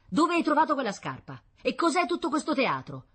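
high, loud exasperated questions; angry interrogation. Acted. Wife questions her husband about the shoe, indignant. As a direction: fire indignant questions at him.

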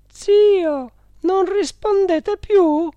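high, loud, drawn-out coaxing; insinuating woman. A narrator's reading. Directress coaxing her silent dead uncle, high insinuating tone. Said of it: "con voce insinuante".

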